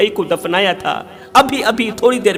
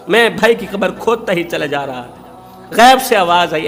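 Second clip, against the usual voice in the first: ordinary talk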